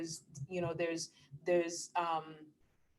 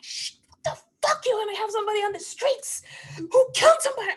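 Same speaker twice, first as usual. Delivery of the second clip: hissed then shouted disbelief, pitched far up; outraged; 8 dB louder, faster than usual. Retold or imitated. Mother reacting to son's news about the cop, outraged outburst. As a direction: spit it out, raging, then blast the question fast and loud.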